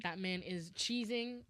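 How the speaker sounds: ordinary talk, nothing marked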